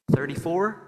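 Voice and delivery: usual speaking voice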